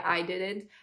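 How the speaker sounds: normal talking voice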